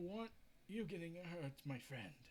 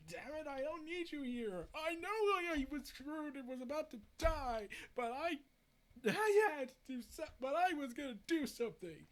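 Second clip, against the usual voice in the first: rising to a loud, higher shout; angry outburst; 8 semitones higher, 6 dB louder than usual. Acted. Aquaman tells his friends off, loud and higher. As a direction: snap at them, building to a yell.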